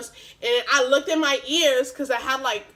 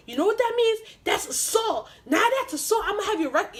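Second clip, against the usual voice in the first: high-pitched mimicked accusation; mock him building a case against you; pitched-up big-guy threat; imitating a threatening official; 9 semitones higher than usual. Acted threat from school employee.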